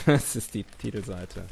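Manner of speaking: laughing. Real, not acted.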